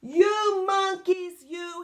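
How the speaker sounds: loud, high, drawn-out scolding; high-pitched storybook outburst; angry peddler. A narrator's reading. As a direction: scold the monkeys loudly, stretching the words. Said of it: "That made the peddler angry".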